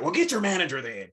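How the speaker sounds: high, fed-up mock demand; irate customer. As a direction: imitate a customer losing his temper. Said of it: "he's just yelling at them".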